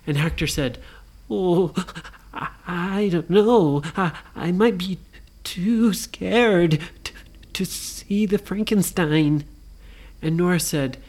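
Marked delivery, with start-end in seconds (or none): 1.3-9.4 slow, stammering scared ghost; hammed-up nervous ghost; timid ghost. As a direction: hesitate and stretch the fearful words. Ghost too scared to visit.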